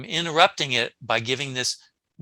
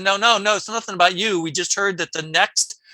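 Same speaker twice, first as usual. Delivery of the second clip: louder, higher quoted protest; retold hasty denial; 8 semitones higher, 8 dB louder than usual. Doctor reassuring the awake patient, emphatic denial. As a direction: insist loudly, as the doctor backtracking.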